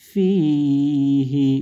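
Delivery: ফিহি very slow, the হি held long; ফিহি recited slowly with a long final হি; drawn-out recitation. Shown as a demonstration. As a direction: stretch ফিহি out in chanting recitation. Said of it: "এক আলিফ মাদ হবে".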